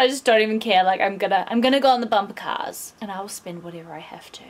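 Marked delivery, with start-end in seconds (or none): 3.0-4.4 whisper. Real, not acted. Confiding aside to camera.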